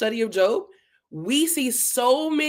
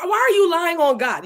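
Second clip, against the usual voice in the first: higher, acted indignant protest; upset challenge; 5 semitones higher, 4 dB louder than usual. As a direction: protest as if God were insulted. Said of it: "I get upset when I feel like".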